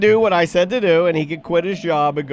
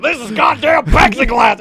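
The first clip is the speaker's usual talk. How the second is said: loud, high outburst imitating an angry landlord; hammed-up furious shout, pitch jumping up; mad landlord; 6 semitones higher, 5 dB louder than usual. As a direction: yell it in mock outrage. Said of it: "then he got mad".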